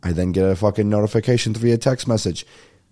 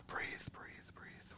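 a soft unvoiced whisper to calm himself; whispered breathing cue; 31 dB quieter, much slower than usual. Real, not acted. Telling himself to calm down, in a hushed whisper. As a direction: whisper it like a calming mantra. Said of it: "We're working on that with therapy now".

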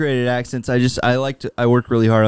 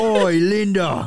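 drawn-out, higher Cockney call; Cockney accent; 7 semitones higher, slower than usual. Acted. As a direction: bellow for the wife in slow Cockney. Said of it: "You're so good at that".

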